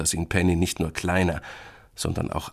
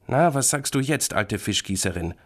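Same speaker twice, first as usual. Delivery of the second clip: put-on mild childish growl, starting higher; a touch of grumpy challenge; grumbling; 6 semitones higher than usual. A narrator's reading. Little brother confronts sister over empty box, mildly grumbling. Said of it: "knurrt Florentin".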